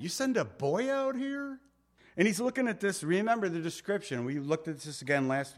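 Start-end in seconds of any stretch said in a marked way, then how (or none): 0.0-1.6 much higher, scornful disbelief; mock outrage, pitched way up; insulted Goliath. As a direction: sneer at the boy sent out to fight. Voicing Goliath's scorn at David.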